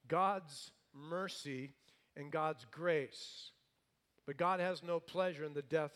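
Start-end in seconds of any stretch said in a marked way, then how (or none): 0.1-3.5 slow and weighty; slow, deliberate preaching stress; slow emphasis. Real, not acted. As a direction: lay each word down slowly. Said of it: "preacher slows for emphasis".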